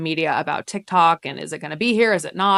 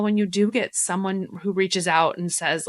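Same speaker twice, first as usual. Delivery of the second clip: ordinary delivery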